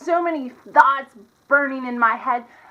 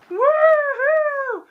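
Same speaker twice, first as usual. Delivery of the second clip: put-on high, cheery Texas accent sign-off; hammed-up Texas accent outro; 9 semitones higher, 3 dB louder, slower than usual. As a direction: whoop it up in a Texas accent, then slow down for subscribe. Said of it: "That's my Texas accent".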